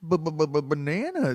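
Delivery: slow stammer on the b; B-b-b-b-banana stammered slowly and loud. Acted. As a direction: get stuck on the b before banana. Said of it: "Dan stammered".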